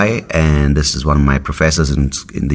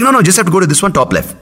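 insistent mum; faster than usual. Retold or imitated. Mum insisting her son press the top left button. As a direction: push him firmly to the top left. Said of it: "she's like".

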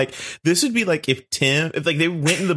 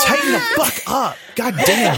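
mock scolding; 9 semitones higher than usual. Mocking Eno, high yell.

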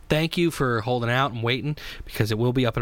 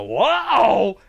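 imitating Rob's whoa; 10 semitones higher, 5 dB louder than usual. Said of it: "you hear Rob go".

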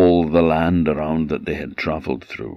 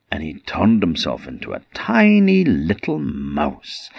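plain reading voice

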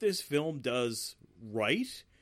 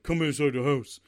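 loud, gruff soldier barking an order; imitating a bossy commando; mock tough Sonya; 5 dB louder than usual. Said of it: "she's like".

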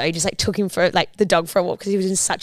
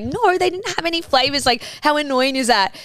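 higher-pitched fake innocence; feigned innocence; 7 semitones higher than usual. Fibbing to partner about the flavours, high feigned innocence. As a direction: cover a fib with mock annoyance. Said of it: "trying to cover 'cause I was trying to pretend".

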